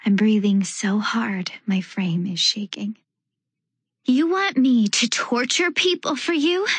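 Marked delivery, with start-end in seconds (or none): 4.0-6.7 high, horrified question; shocked disbelief. A narrator's reading. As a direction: recoil in horror as you ask.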